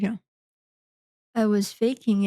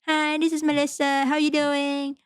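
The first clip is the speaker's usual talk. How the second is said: imitating a chirpy, higher phone opener; mock perky sales greeting; 5 semitones higher than usual. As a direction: pitch it up like an overeager caller. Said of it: "like, screaming".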